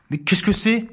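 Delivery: ordinary delivery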